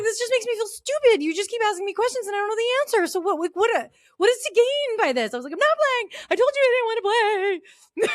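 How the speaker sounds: high, whiny mock-crying protest. Teen refusing trivia with friends, high whiny mock sobbing. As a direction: wail it like a teen in tears. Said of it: "started crying".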